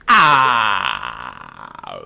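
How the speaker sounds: loud low startled cry; startled yelp. Real, not acted. Placing building, sudden startled yelp.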